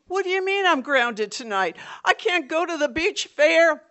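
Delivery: high, loud whiny protest; whining protest. Retold or imitated. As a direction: complain about being grounded. Brother protesting grounding.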